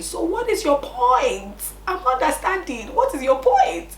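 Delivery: indignant challenge. Retold or imitated. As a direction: press her for the point, baffled. Challenging the boss.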